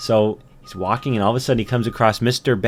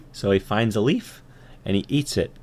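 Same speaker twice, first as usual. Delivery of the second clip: ordinary delivery, nothing marked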